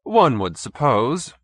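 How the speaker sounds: slow, polished condescension; urbane. A narrator's reading. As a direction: talk down politely. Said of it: "Stolz continued urbanely".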